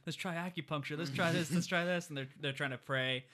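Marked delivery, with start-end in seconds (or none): 0.0-2.1 rising desperate pleading. Retold or imitated. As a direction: grow frantic trying everything. Desperate players building.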